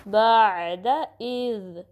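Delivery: slow बाद इज़ with ayn drawn from the throat; measured बाद इज़; flowing joined recitation. Shown as a demonstration. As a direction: flow through the ayn of बाद, then voiced dental इज़. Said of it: "और flow होगी".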